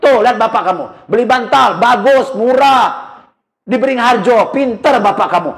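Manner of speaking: mother proudly praising father to their son; proud wife. Retold or imitated. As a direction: brag to the child about dad. Wife bragging to waking child.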